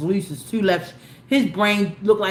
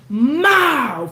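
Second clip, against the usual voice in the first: slap noise; 5 semitones higher, 5 dB louder than usual. Retold or imitated. Imitating old man's slap.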